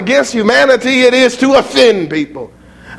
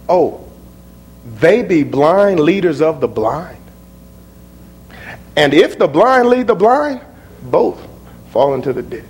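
softer, unhurried, steady; calm, quiet Jesus; 5 semitones lower, 4 dB quieter than usual. Acted. Jesus answering Peter, calm and quiet. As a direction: speak it calmly like a patient teacher.

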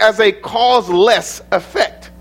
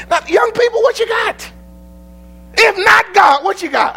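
challenging shout; 10 semitones higher than usual. Real, not acted. Preacher challenging the youth.

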